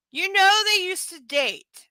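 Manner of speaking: normal talking voice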